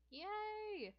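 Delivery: put-on high-pitched little-kid cheer; shouting. A narrator's reading. Six-year-old brother thrilled about the pass, shouting. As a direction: cheer it way up high, stretching the vowel. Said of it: "Ike shouts".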